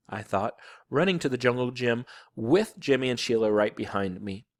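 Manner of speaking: ordinary delivery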